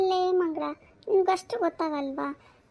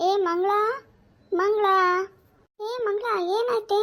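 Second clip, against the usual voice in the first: higher-pitched neighbourly call; alarmed, raised calling voice; 4 semitones higher than usual. Acted. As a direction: call her name from the doorway. Neighbour calls Mangala from the door.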